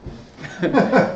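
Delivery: a short high breathy laugh of doubt; skeptical laugh. Shown as a demonstration. Lecturer mimes a doubtful listener with a skeptical laugh. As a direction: scoff lightly through a laugh. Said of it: "which communicates a lot".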